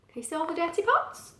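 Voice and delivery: usual speaking voice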